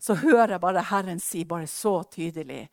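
normal talking voice

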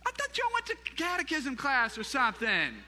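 high, loud teasing complaint; playful, exasperated outburst; mock scolding. Mock scolding congregation for not reciting.

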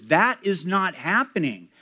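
usual speaking voice

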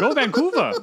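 high mock-Canadian cheer; playful Canadian rooting; Canadian accent. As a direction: cheer in fake Canadian, half laughing. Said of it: "How, how's my Canadian accent?".

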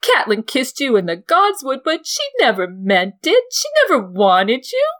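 high, loud, sobbing, building to a shriek; Lysa's jealousy swelling from complaint to scream; hysterical jealous aunt, rising to a scream. Acted. Lysa to Littlefinger about Catelyn, building from sobs to a shrieking cry.

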